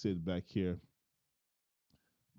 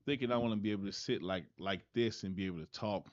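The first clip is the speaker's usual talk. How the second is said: ordinary talk, nothing marked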